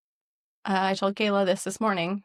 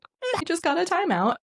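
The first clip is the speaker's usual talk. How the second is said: put-on child voice; 10 semitones higher than usual. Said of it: "she's like".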